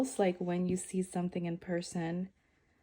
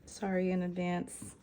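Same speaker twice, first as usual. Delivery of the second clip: ordinary talk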